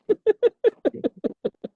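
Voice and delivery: high burst of laughter; big laugh. Real, not acted. Laughs after the quote.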